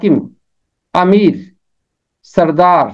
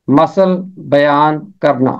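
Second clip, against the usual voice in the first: normal talking voice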